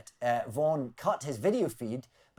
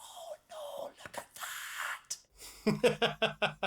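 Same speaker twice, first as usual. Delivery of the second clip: furious but hushed whisper; angry whisper; 5 dB quieter than usual. Acted. Furious library staff keeping silence in the library, angry whisper. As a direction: whisper the outrage, as quietly as possible. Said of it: "they were furious, angrily saying".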